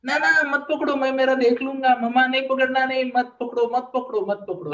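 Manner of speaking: high, loud, insistent protest; squabbling child. Acted. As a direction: act a child arguing to be left alone. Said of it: "अब आप झगड़ रहे हो कि".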